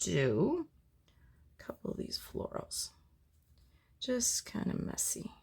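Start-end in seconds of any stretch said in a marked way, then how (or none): 4.4-5.3 soft unvoiced whisper. Real, not acted. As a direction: whisper it close to the mic. Painting vlog, whispered commentary.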